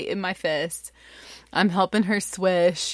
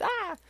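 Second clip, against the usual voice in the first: high, short "ah" of overwhelm; mock panicked cry; 5 dB quieter than usual. Parents facing bloody mouth, mock panicked cry. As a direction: yelp in pretend panic.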